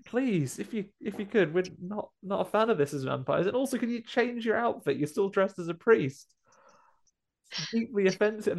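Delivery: high, prim scolding voice; offended vampire. Retold or imitated. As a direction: primly scold the priest.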